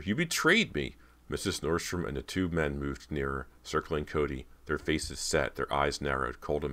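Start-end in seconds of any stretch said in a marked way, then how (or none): 0.0-1.0 high-pitched cry of betrayal; distraught, shrieking reproach; screeched accusation. A narrator's reading. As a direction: screech it, wounded and outraged. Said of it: "Cody screeched at her sister".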